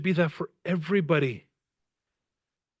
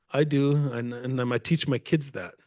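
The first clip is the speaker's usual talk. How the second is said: ordinary talk, nothing marked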